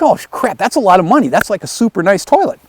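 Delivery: high-pitched retold dismay. Builder reacts to five hundred dollar fine, high mock dismay. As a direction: act out wincing at the cost.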